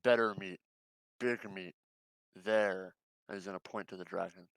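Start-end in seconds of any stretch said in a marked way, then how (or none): 0.0-2.9 talking through teeth. Acted. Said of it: "talking through his teeth will go".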